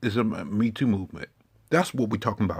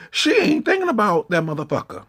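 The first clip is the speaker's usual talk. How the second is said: much higher and louder, scornful; indignant outburst; 7 semitones higher, 5 dB louder than usual. Acted. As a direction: fling it out in disbelief. Ranting about Melania.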